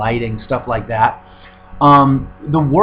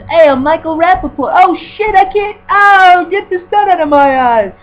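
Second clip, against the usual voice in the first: much higher, loud, strained goofy voice squinting at the sun; Michael Rapaport impression; 8 dB louder than usual. Imagining Rapaport as the vampire, loud high hammed-up whine. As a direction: ham up a sun-blinded ginger vampire. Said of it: "like, he's".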